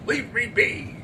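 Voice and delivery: snapping. Acted. Andrew to Mister Almy, snapping angrily. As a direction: snap it and pull away. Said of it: "he said, snapping back his arm".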